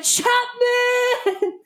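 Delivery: high laughing fan shriek; excited screaming. Retold or imitated. Texting a friend about Chapman, laughing shriek. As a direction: shriek your love through laughter. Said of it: "I was like".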